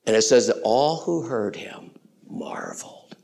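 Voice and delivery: soft whisper of wonder; a whispered aside in awe; hushed whisper. Real, not acted. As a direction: drop to a whisper. Preacher drops to a hush.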